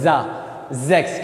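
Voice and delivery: ज़ेक्स slowly, opening with buzzing "z"; voiced Z sound. Shown as a demonstration. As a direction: model जा, then ज़ेक्स as "zeks". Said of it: "वो जेड की तरह होती है".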